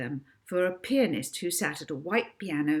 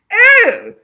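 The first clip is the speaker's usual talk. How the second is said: disdainful; 20 dB louder than usual. Acted. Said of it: "she said disdainfully".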